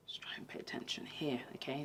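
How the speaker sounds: hushed whisper over the noise. Real, not acted. Whispering to viewers over fireworks noise. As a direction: lean in and whisper it.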